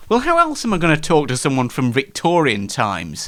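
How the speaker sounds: normal talking voice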